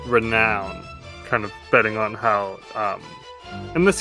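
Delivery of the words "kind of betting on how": normal talking voice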